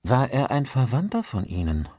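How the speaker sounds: lightly voiced cultivated stranger, politely inquiring. A narrator's reading. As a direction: ask with a touch of refinement. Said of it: "eine kultivierte Stimme erklang".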